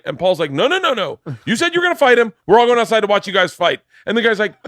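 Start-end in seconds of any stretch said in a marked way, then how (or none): 0.5-3.8 raised, higher mock insistence; insistent club owner. Club owner refusing to let comic back out, insistent. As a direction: imitate an owner overruling an objection.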